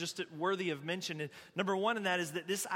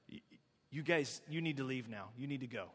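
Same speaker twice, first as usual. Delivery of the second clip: low, hushed imitation of a frightened mother; imitated freaky fear; 6 semitones lower, 4 dB quieter than usual. Retold mother sending the gift-bringers away, low and freaked out. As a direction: drop low and soft, haltingly warning visitors off. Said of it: "this sudden, like, freaky no".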